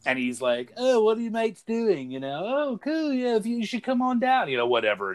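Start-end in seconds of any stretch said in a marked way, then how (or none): 0.8-4.5 put-on high, campy Cockney British accent. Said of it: "in his little Cockney British accent".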